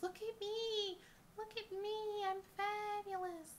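showy abalone voice. Pretend. Abalone pendant showing off to viewers, high diva squeal. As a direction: squeal it like a diva who wants all eyes on her. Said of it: "it just screams".

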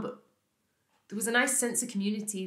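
normal talking voice

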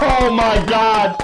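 coughing, shrieking laughter; wheezing laugh. Real, not acted. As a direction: laugh till choking. Choking laugh, slow shout.

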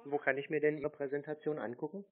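ordinary talk

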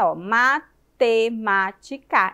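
"matemática" slowly chopped into ma-te-má-ti-ca; syllable by syllable. Shown as a demonstration. Teacher confirming five syllables to children, slow and separated. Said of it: "Cinco vezes".

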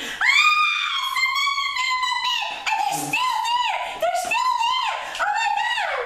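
very high squealing cub shout; Baby Bear screaming. Acted. Baby bear discovering Goldilocks still in his bed, high screaming. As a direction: scream it like a frantic little bear. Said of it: "And Baby Bear screams".

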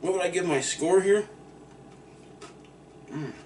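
chewing while talking; speaking between bites; mouth full of pizza. Real, not acted.

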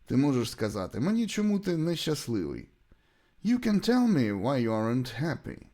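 normal talking voice

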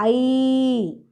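ஐ held long; a drawn-out, lengthened ஐ; long vowel. Shown as a demonstration. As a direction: glide slowly through ஐ. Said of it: "அதனால நீண்டு உச்சரிக்கணும்".

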